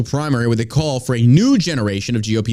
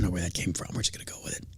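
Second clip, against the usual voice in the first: whisper; 7 semitones lower, 13 dB quieter, much faster than usual. Real, not acted. Aside after the bit.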